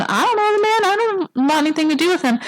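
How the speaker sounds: a frightened disciple insisting he's never met him; Peter denying Jesus. Retold or imitated. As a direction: blurt out the denial in a high voice. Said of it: "Peter's out there going".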